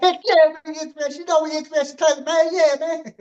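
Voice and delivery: high-pitched, excited coach imitation; coach's excited high voice. Host imitating the coach agreeing to the show, high-pitched and excited. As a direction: squeak it out like an overjoyed coach. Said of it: "he's like".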